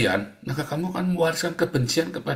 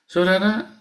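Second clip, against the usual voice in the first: ordinary talk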